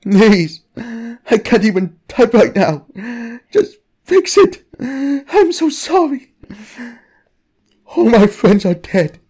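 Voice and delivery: distraught, halting pleas through tears; tearful guilty confessor. Acted. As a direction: beg and apologize as if breaking down crying. Distraught confession read in character.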